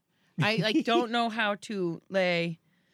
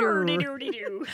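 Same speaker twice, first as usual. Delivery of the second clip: mock Irish gibberish. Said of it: "Anytime I have to do an Irish accent".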